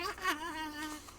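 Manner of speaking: high maniacal cackle; Joker laugh. Acted. Joker laughing at the doctor, high cackle. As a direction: cackle like a madman. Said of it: "of course he's laughing".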